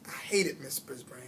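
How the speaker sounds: hissed under the breath; bitter whisper. A narrator's reading. Hamster whispering his hatred of the teacher. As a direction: whisper the grudge.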